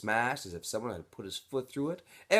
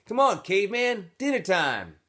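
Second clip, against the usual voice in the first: much higher, louder call across the room; 11 semitones higher, 9 dB louder than usual. Acted. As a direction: call him over to dinner. Boys calling to Stanley.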